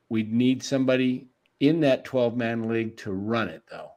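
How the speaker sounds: usual speaking voice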